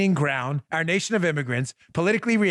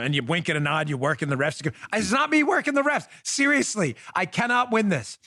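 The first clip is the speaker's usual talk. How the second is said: usual speaking voice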